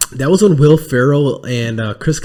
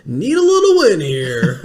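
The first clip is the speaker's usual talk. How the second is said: slow, higher stretched-out movie call; Tommy Boy; 5 semitones higher than usual. Retold or imitated. Host quotes a favorite comedy movie, drawn-out calling. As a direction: call it out, drawing the words out. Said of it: "You remember Tommy Boy's like".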